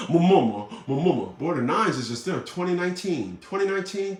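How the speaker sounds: normal talking voice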